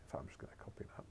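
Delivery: quick, soft whispered note to self; hurried whisper to herself; whispered aside. Real, not acted. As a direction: mutter it fast under your breath. Muttered aside while working.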